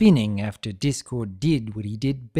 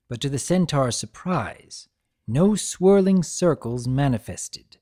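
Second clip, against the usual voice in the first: ordinary delivery, nothing marked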